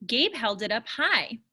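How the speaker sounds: ordinary delivery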